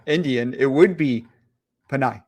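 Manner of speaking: ordinary delivery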